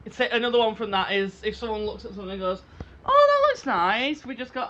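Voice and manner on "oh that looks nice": slow, loud, drawn-out Australian voice; Australian accent. Retold or imitated. Quoting the Australian sitcom, slow Australian accent. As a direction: savour it in a broad Australian accent. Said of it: "You've gotta do an Australian accent".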